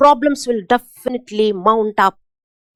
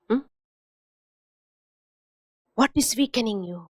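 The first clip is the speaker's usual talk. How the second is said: ordinary talk, nothing marked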